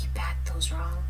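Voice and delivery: hushed whisper scolding the bagger; whispered complaint. Retold or imitated. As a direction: whisper it like a sly reproach. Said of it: "and be like".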